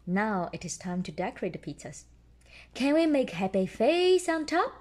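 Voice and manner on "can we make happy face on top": higher, louder piglet question; Peppa voice. A narrator's reading. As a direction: ask like an eager little pig girl. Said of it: "Peppa asks".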